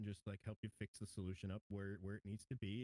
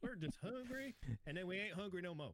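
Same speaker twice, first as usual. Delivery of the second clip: Southern accent; 7 semitones higher, 4 dB louder than usual. Shown as a demonstration. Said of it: "you gotta say it like Southern".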